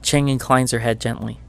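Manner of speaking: ordinary talk, nothing marked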